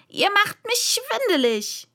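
high-pitched, agitated complaint; ranting queen. A narrator's reading. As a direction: scold them in a high queen's voice. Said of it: "eiferte die Königin".

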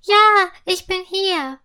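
high, loud "ja", easing on the rest; calling out. A narrator's reading. Sugar fairy to her would-be rescuer, calling out. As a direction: shout back to be found. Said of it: "„Hilfe".

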